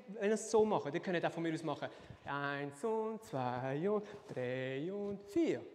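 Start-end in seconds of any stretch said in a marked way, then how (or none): 2.3-5.6 low, very drawn-out counting; slow count. Shown as a demonstration. Teacher showing the move can be simple, slow low count. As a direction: stretch every number out slowly. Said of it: "Lento heisst langsam".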